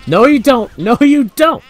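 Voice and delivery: loud, high cry of defiance; triumphant shout. Real, not acted. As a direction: shout it to stop someone escaping. Catching an escaping ghost in game.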